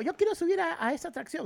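high, soft imitation of a child; imitated daughter. Camila asks dad to go on the ride, imitated daughter. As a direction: pitch it up lightly like an eager child.

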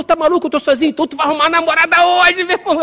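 high, loud back-and-forth; animated mock yelling; retold shouting match. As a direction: holler it like a heated street exchange. Shouted retold exchange with stranger.

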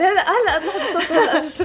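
laughing. Real, not acted. Laughing while interrupted by partner.

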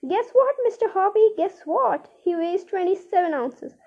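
calling up gleefully to her neighbour; excited shout. A narrator's reading. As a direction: neighbour shouting her news up the balcony, overjoyed. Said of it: "and shouting".

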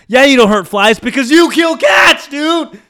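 high, loud exasperated outburst; acted-out incredulous shout; indignant. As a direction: throw the accusation back in mock outrage. Indignant thought about passenger.

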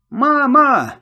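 high, loud cry of fear; screaming for mum. A narrator's reading. Boy screaming for his mother as pirates close in, frightened cry. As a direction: cry out for your mother in panic. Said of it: "завопил я".

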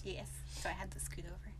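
soft unvoiced aside; whispering. Real, not acted. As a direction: whisper it close. Quiet aside in closet.

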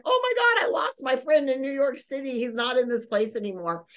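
high-pitched "oh my God", then easing down; retold panic. Storyteller who lost a friend in New York, mock panic. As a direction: act out sudden worry, starting high. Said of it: "I'm super worried".

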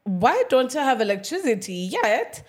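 high-pitched baffled self-quote. Retold or imitated. Speaker recounting finding the power off, baffled. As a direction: act out puzzled disbelief. Acted dismay at power cut.

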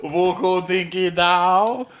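slow, high, hammed-up fake French; cartoon French accent; bad French accent. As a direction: drag it out in a mock French accent. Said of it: "get my French on".

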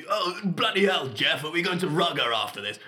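posh toff. Retold or imitated. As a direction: ham up a rich toff asking his mate to rugby. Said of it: "like a toff who clearly had so much money".

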